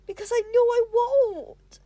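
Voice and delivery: loud, high, slow cry of despair; loud despairing shout; frustrated outburst. Acted. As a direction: throw it out in frustration.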